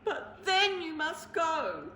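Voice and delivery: slow, tearful plea; sniffling. Acted. Bear tearfully tells the mouse he must leave. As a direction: sniff it out, worn down. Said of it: "he sniffled".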